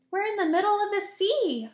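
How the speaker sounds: put-on a boy seeing the open sea, high startled cry. A narrator's reading. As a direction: cry out at the sudden discovery. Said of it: "cried James".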